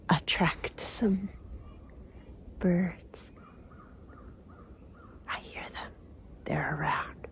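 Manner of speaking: soft whisper, not scaring birds. Real, not acted. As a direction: hush it so the birds stay. Trying not to scare birds.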